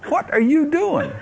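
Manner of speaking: bewildered at his own mouth; high incredulous "what are you doing"; baffled self-question. Retold or imitated. Asking himself during strange babble.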